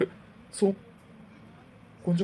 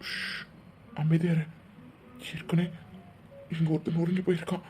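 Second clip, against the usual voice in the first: low, softer warning under his breath; hushed; 5 semitones lower, 7 dB quieter than usual. Acted. Man hushing his crying cousin in the hospital, quietly. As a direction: keep it down so the broken man won't hear.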